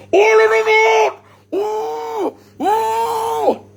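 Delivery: very high shrieking yell; high shouted cry. Acted. Acting out the prank at the office desk, a high shriek. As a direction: shriek it out, hammed up.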